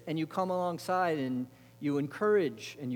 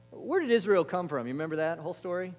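ordinary talk

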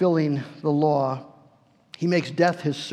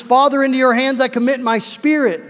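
a high, loud cry to the Father; Jesus's last words cried out loud from the cross; 5 semitones higher, 5 dB louder than usual. Acted. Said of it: "having cried out with a loud voice".

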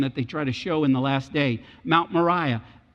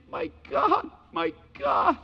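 anguished cry; 11 semitones higher, slower than usual. Retold or imitated. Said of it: "all he can say is".

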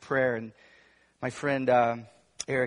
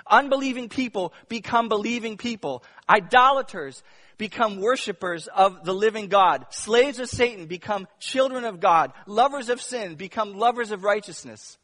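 higher, louder preaching, building with fervor; preaching at full force; 6 semitones higher, 7 dB louder than usual. Acted. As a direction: preach it out to the congregation.

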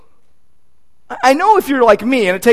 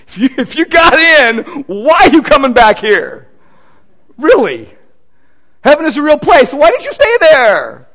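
incredulous exclamation; 5 semitones higher, 6 dB louder than usual. Real, not acted. Mocks people claiming heaven trips.

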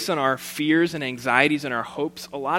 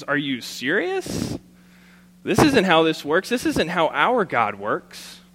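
incredulous; 3 semitones higher, 5 dB louder, slower than usual. Acted. King tears his clothes.

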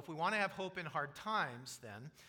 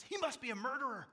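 much higher, startled crowd cry; shocked accusation; 10 semitones higher, 5 dB louder than usual. Retold or imitated. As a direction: gasp it out like an alarmed onlooker. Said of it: "the people's reaction is".

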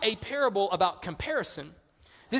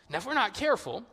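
ordinary delivery

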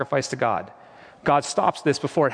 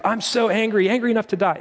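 raised, worked-up angry voice; acting out a prophet's anger, pitched up high; 7 semitones higher than usual. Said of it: "Jonah's tone was, like, up here".

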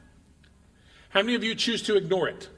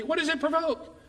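pitched up high on What, falling to provoke; lifted rhetorical question; 8 semitones higher than usual. Real, not acted. Preacher repeating the question to the congregation, much higher on What. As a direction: ask it again, much higher, to drive it home.

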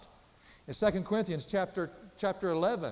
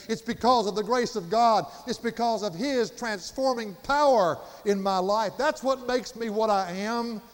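higher, louder emphatic testimony; impassioned preaching; 5 semitones higher, 5 dB louder than usual. Real, not acted. Preacher crediting God's grace for his life, impassioned. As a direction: press home every phrase with conviction.

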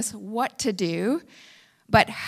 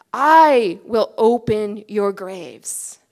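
slow, loud, weighty proclamation, each word landed; emphatic divine promise; 5 dB louder, slower than usual. Acted. Preacher voicing God, slow and emphatic. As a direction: pronounce God's promise over the crowd. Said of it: "His word is so emphatic".

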